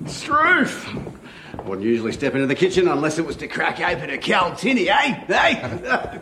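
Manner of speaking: hammed-up Crocodile Dundee Australian accent; Aussie bloke. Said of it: "Why should you be the only one who gets to do an accent".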